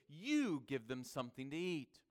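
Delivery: emphatic You at the head of the command; stress on You. Acted. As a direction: lean hard on the opening You. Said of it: "in the Greek is emphatic".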